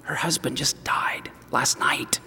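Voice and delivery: unvoiced, slow, soft whisper. Hairdresser whispering the bad news to the pastor, hushed whisper. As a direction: breathe it out so the widow won't hear.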